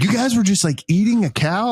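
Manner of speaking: high, baffled question; incredulous question. Retold or imitated. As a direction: play the captain who can't believe it. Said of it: "And he's like".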